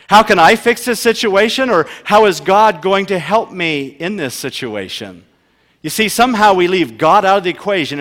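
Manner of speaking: loud, driving sermon voice; preaching at full force. Acted. Pastor preaching loudly to his congregation on anxiety.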